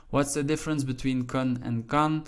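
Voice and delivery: ordinary delivery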